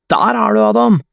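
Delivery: high-pitched excited shout; calling out. A narrator's reading. David greets Adam in game chat, calling out high. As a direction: call it out high to a friend. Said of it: "roper David".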